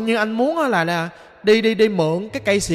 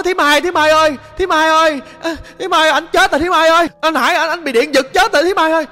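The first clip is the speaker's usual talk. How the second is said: high, loud imitated alarm call; retold cry for help; 7 semitones higher, 6 dB louder than usual. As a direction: holler for auntie as if raising the alarm. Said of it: "Nó la làng lên vậy đó".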